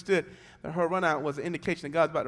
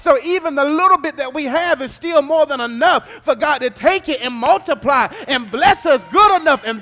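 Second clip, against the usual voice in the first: rising, much louder sung preaching cadence over music; full-force preaching; 11 semitones higher, 18 dB louder than usual. Acted. Pastor preaching to the congregation, sung and shouted.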